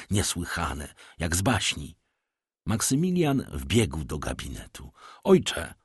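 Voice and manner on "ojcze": sharply higher cry; calling out. A narrator's reading. Son runs into his father's office, urgent call. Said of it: "zawołał".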